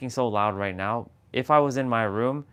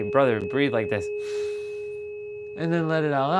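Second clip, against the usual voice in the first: higher playful breathing instruction, big "ah"; imitating Jessa; 4 semitones higher than usual. Sister teaching brother to breathe out, playful. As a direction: coach a brother to exhale. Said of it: "she used to say".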